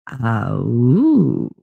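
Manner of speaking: playful drawn-out wolf howl, swooping up. Acted. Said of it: "and howls".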